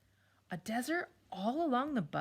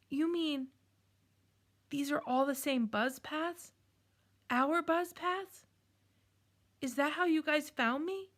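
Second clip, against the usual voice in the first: rising astonished questions; higher, slowing on Our Buzz Paths; surprised; 7 semitones higher than usual. Acted. Jed realizes friends followed paths.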